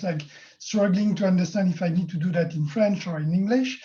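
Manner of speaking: normal talking voice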